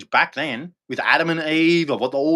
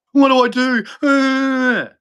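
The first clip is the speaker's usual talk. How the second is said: mock desperate plea; 10 semitones higher, slower than usual. Imitating desperate believer.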